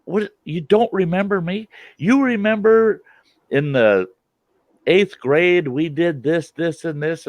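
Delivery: high, pushy hurt protest; mock indignant classmate. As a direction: play the classmate who can't believe you forgot. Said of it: "And they go".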